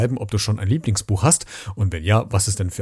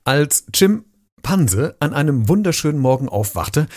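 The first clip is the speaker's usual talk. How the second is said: plain reading voice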